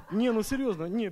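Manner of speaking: laughing. Real, not acted. Testifier at church laughing, honestly insisting he's serious. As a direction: laugh it off while insisting it's true.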